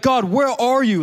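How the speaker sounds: retold yell. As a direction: call out to God, half-shouting, slowing down. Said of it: "Like, I'm yelling".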